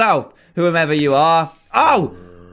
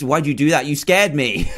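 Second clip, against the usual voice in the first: ordinary delivery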